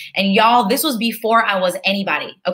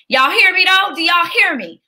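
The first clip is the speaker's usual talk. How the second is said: higher and louder, calling to the congregation; preaching call-out; 8 semitones higher, 5 dB louder than usual. Real, not acted. Coach rallying her webinar audience, higher and louder, preacher style. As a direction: preach it, rouse the room. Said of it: "I'm taking you to church right now".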